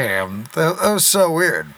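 ordinary talk, nothing marked

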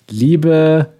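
strict teacher. Pretend. Mock teacher addressing class.

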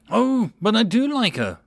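a big dragon pining, voiced up high; lightly voiced dragon, wistful sigh in it. A narrator's reading. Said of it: "Blue Thunder sighed".

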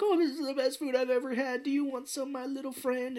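high, weepy put-on voice; mock tearful. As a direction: imitate a chef weeping with joy. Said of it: "and he's crying".

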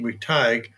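"McTighe", with the vowel of "tie" and a hard g; McTighe with long I. Shown as a demonstration. Host offering possible pronunciations of the guest's name, "McTighe" with long I. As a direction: say "McTighe" with the second syllable like "tyge". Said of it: "correct pronunciation of your name".

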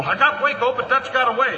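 urgent shouting. Acted. Men after the shootout, shouting urgently. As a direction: shout it out amid the chaos.

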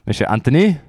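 a light mock French accent. Host introducing his other co-host, in a light mock French accent. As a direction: announce the name the way a French host might. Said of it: "shouldn't have done a French accent".

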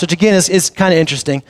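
ordinary delivery

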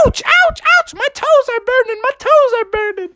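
very high, squealing cartoon mother in pain; panicked high-pitched mom voice; Froggy's mom. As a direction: yelp in a high falsetto. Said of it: "And she's like".

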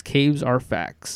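Caves are facts, slow and soft, each word spaced; slogan read. Pretend. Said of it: "make a shirt that just says".

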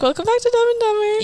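high, mocking intro voice; a hammed-up podcast intro; bad intro voice. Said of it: "You're better at doing the intro than I am".

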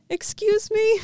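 high, timid fan voice; nervous high excuse me. Retold or imitated. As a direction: squeak it out shyly. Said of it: "And I go".

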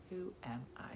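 unvoiced whisper to herself; whispered self-mocking aside. Real, not acted. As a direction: breathe it out, no voice.

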